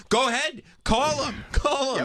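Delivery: high-pitched acted dare; mock bluffing. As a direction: push out a squeaky, hammed-up bluff. Acting out a caller's panicked bluff.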